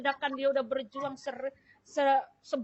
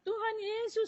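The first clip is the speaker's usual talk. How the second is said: higher, lightly wailed imitation; retold tearful calling; 8 semitones higher than usual. Patient cries briefly to Jesus, imitated higher plaintive call. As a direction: raise the pitch in a mimicked plea. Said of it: "Dia nangis cuma berapa lama".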